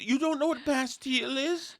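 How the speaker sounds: high, smug know-it-all question; nerd voice. Retold or imitated. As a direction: mimic her condescending nerd tone. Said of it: "this overconfident nerd voice".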